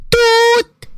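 high playful toot like a party horn; mock horn toot. Sister teasing brother in his ear, high mock toot. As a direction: blast a silly horn sound. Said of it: "اللي بتزمر في ودانه".

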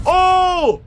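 loud, high cry of shock; sudden high "oh!", falling at the end; startled reaction shout. Real, not acted. Reacting to video.

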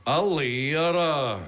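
langsam und gedehnt, voller Verachtung; verächtlich. Acted. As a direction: das Wort genüsslich herabsetzen. Major verachtet den Lehrerberuf. (Major spricht abfällig über Lehrer)

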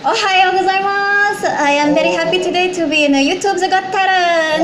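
a lightly put-on Japanese accent with a bow in the voice. Guest showing off to the hosts, in a light Japanese accent. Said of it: "the Japanese accent".

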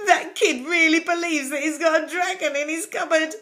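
put-on a postwoman tickled by the boy's fancy; laughing postwoman. A narrator's reading. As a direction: chuckle at the silly kid. Postwoman mocks the boy.

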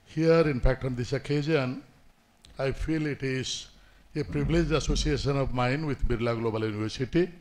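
usual speaking voice

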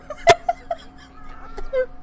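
high teary laugh, half crying; hammed-up laugh-sob. Practicing acting, fake laugh-cries over the game. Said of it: "trying to practice my acting".

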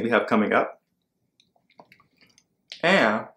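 chewing popcorn while talking; speaking between bites of snack; mouth full. Real, not acted. Seen 5: eats popcorn just before; lips closed, chewing-like mouth while speaking.

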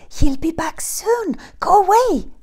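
whispered, rising sharply on go away; urgent whisper. A narrator's reading. Old woman urging Jack to leave, whispered. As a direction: hiss a hurried warning under your breath. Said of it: "she whispered".

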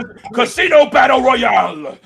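slow, loud, high announcer yell; wrestling promo shout. Retold or imitated. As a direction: drag it out like a ring announcer. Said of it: "he's gonna go".

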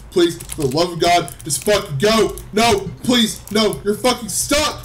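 high, loud begging screams; desperate yelling. Real, not acted. Gamer screaming at his stuck character, desperate. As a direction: scream at the game to let go.

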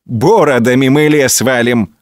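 ordinary delivery, nothing marked

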